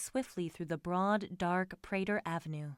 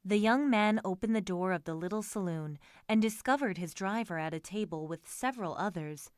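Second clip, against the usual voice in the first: plain reading voice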